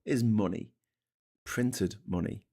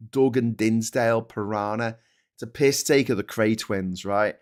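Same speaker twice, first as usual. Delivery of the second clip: normal talking voice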